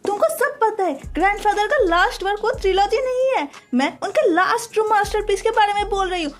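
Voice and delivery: shouting her accusation at him; screaming. A narrator's reading. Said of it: "Ariana चीखते हुए बोलने लगते हैं".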